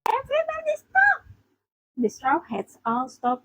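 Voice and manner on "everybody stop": very high, squeaky little-reindeer cry; distressed outcry. Acted. Chopper stopping the bickering, very high distressed cry. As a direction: cry for a halt in a tiny high voice.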